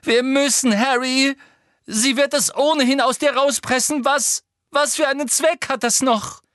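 high and loud, near tears; desperate pleading. A narrator's reading. Hermine pleading with Harry, high and loud. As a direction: plead in panic.

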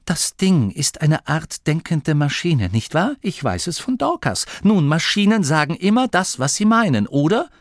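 high, animated reasoning; Grimma, insistent. Acted. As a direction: lead him step by step to the point. (eindringlich argumentierend)

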